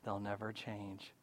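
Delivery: very low, hushed aside; dismissive private thought. Acted. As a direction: think it quietly to yourself. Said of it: "kind of thinking in the back of my mind".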